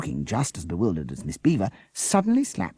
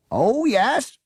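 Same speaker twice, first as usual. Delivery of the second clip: mock-agreeing, dripping sarcasm; sarcastic; 7 dB louder than usual. A narrator's reading. Policeman mocking the teacher, sarcastic. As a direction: pretend to believe her.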